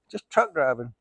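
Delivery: ordinary talk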